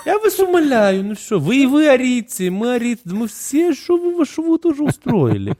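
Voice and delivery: put-on high sing-song Odessa accent; exasperated Odessa auntie; odessa russian accent. Joking about Aryans and Russians. (одесский акцент, с возмущением)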